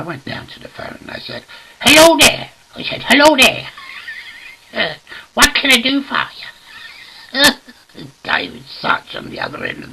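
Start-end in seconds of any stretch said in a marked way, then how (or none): 1.8-2.5 loud, high-pitched hello; over-the-top bright telephone answer; cheery phone greeting. Acted. 3.0-8.1 loud, high put-on greeting; hammed-up high telephone voice; comic phone voice.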